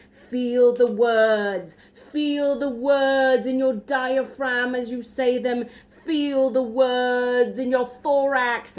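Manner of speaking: loud, slow British Shakespeare teacher impression; emphatic British accent. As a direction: intone it like a British drama teacher, loud and drawn out. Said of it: "not very high-class British accent".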